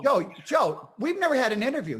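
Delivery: loud, high excited calling out. Real, not acted. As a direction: call his name out, thrilled.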